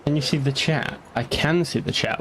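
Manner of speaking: ordinary delivery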